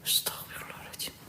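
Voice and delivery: breathless scared whisper. Real, not acted. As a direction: whisper it almost silently. Praying during ghost summoning.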